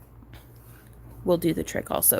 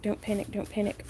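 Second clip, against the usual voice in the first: softer repeated self-reassurance; muttered to self; 8 dB quieter than usual. Real, not acted. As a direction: murmur it to yourself, fading.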